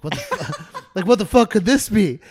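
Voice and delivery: high-pitched shocked retelling, breaking into laughter; laughing mock alarm; startled exclamation. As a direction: act out being startled, loud and laughing. Retelling startled reaction to the car.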